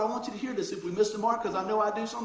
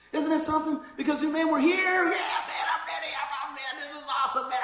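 excited; 6 semitones higher than usual. Retold or imitated. Mocking eager Sunday churchgoer.

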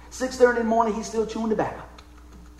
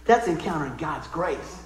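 ordinary talk

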